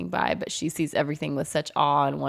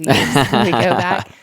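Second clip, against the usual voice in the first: laughing; 3 semitones lower, 9 dB louder than usual. Pretend. Imagined worn-out future self.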